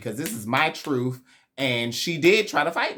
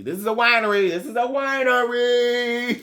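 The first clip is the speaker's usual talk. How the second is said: pitched-up, hammed-up protest; mock exclaiming; 9 semitones higher, 4 dB louder than usual. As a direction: imitate an exasperated retort, stretching the repeat. Retold mocking retort about therapy.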